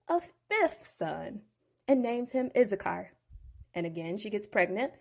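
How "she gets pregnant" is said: ordinary delivery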